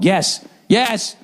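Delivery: high, urgent repeated answer; mock panic. Caller jumping in over the robot's question, panicking. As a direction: blurt it in rising panic. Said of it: "I immediately start pan-, panicking".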